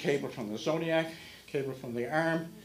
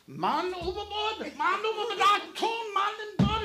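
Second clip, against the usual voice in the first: man overboard shout; 3 dB louder than usual. Retold or imitated. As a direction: cry it out across the deck. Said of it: "First mate's going".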